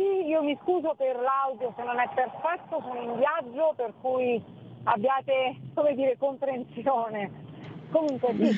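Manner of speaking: ordinary talk, nothing marked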